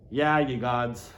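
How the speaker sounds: usual speaking voice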